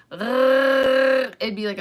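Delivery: long, loud throat trill; fake rolled R. Shown as a demonstration. Showing how they fake a tongue roll, long throaty trill. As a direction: hold a buzzing R in the throat. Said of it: "I was doing it with my throat".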